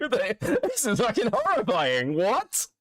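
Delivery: high, laughing in horror; horrified laughing. Real, not acted. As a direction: laugh through disbelief.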